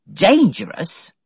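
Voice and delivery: mild angry disbelief, drawn out. A narrator's reading. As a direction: housekeeper offended at the school's charge, angry. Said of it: "Missus Grose was angry now".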